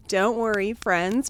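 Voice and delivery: ordinary delivery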